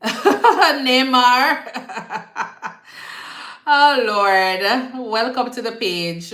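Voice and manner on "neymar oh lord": laughs through the name Neymar, higher and louder; laughing. Real, not acted. She laughs greeting the viewer Neymar, laughing. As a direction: crack up while saying Neymar.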